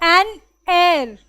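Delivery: "an heir" drawn out slowly and loud, h silent; demonstrated HEIR pronunciation. Shown as a demonstration. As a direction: stretch "heir" so it sounds exactly like "air". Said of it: "this is pronounced as". ("an heir" को धीरे, ज़ोर से, h चुप रखकर)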